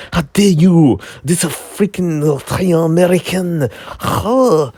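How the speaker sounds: indignant mock accent, pitched high; [name]. Creator objecting to the impression of him, in a mock foreign accent. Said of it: "That is not my accent".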